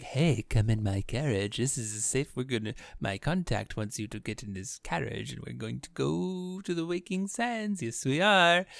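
a hint of a lurking con man; suspicious stranger. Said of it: "Comes in, is like".

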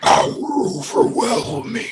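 high, slow drawn-out awe; awed. Acted. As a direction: stretch it out in wonder. Player echoes GM in awe.

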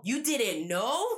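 mock incredulous question; incredulous echo. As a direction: throw the excuse back in mock disbelief. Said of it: "He's like".

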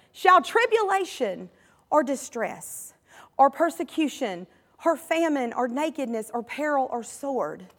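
usual speaking voice

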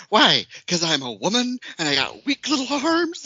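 high, loud indignant woman; hammed-up outrage climbing into a laugh; mock-indignant comedian. Host imitating a TikTok comedian to her co-host, mock indignant. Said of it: "And she's like".

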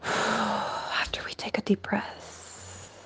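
breathy slow whisper; calming breath. Real, not acted. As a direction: exhale into a very soft, slow whisper.

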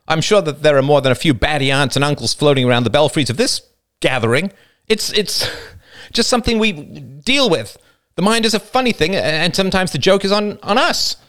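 high, strained, stumbling over words; pressured speech. A narrator's reading. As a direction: push on nervously, pitch climbing as you go. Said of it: "His speech was pressured".

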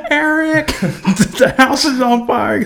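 high, slow mock-frantic wife voice, laughing; mock wife panic. Friend mocking the wife waking him, high and frantic.

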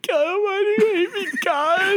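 put-on whiny kid to God. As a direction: whine up at God like a wronged kid. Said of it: "I'll play, um, God talking to you".

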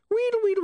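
very high wheedling gibberish; high squeaks dropping at the end; rewind squeal. Acted. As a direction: squeal like a tape winding back. Imp imitates rewinding noise.

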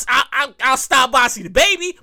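ordinary talk

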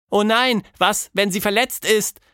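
put-on alarmed childlike voice; worried girl. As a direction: panic about the hurt caterpillar. Tilda fears she hurt it.

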